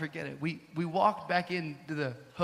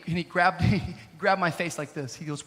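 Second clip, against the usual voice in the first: normal talking voice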